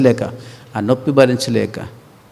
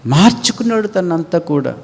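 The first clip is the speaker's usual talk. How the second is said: normal talking voice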